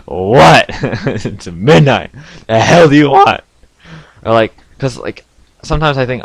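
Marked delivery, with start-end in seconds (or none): 0.1-3.4 loud, higher, indignant retort; half-laughing irritated comeback, raised voice; mock exasperation. Answering the phantom caller at midnight, exasperated and loud.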